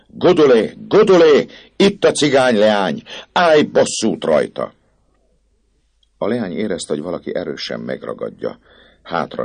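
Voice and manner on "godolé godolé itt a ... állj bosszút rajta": much higher, much louder calling out; loud shout. Acted. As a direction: yell to her across the square. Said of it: "hangosan elkiáltotta magát a pap". (a pap hangosan kiált a remeteasszonynak)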